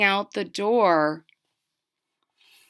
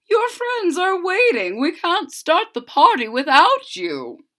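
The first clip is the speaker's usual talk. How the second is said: coaxing teacher; 10 semitones higher, 5 dB louder than usual. Acted.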